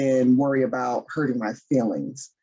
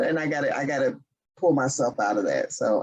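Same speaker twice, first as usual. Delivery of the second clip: ordinary delivery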